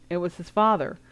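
usual speaking voice

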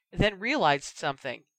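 plain reading voice

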